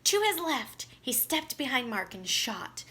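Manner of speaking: plain reading voice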